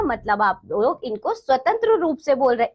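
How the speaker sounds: usual speaking voice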